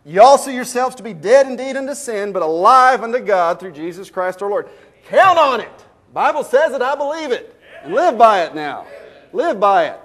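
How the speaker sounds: high, loud preacher's climax; preaching at full force. Acted. Preacher rousing the church to count on the verse, loud and high. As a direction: lift the congregation, ringing out each line.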